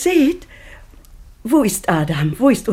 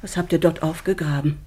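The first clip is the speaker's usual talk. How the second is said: ordinary talk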